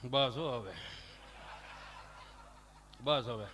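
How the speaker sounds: low-pitched, subdued shushing; acted low hushing; father hushing child. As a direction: settle a crying child wearily low. Father trying to quiet crying child.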